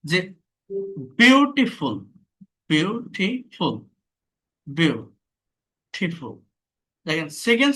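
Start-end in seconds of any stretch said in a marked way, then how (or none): 1.2-1.9 beautiful with a high, loud first syllable; stress on first syllable. Shown as a demonstration. Teacher demonstrating pressure on the U, beautiful stressed at the start. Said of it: "ইউ এর উপর আমাকে চাপ দিতে হচ্ছে". 2.7-6.3 beautiful slowly broken into parts; split into syllables. Shown as a demonstration. Teacher showing the syllables, beautiful slowly split. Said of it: "তিনটা syllable আছে".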